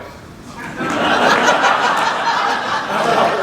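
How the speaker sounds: breathy rueful laugh, no words; real laugh. Real, not acted. Officer laughing at the declined city card, unvoiced laugh. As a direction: laugh at the absurdity.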